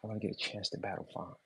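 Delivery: mock whisper, soft and breathless; mocking whiny fan. Rapper mocks rivals begging to battle [name], hushed and whiny. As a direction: sneer at him in a hushed fan voice. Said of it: "walking around saying".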